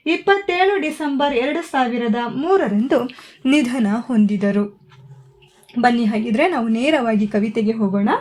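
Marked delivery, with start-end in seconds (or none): none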